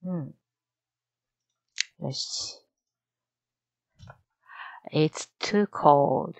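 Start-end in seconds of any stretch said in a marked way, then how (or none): 4.9-6.3 very slow, deliberate shadowing; slow repeat. Shown as a demonstration. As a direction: drag each word out slowly. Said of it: "learner repeats the model line".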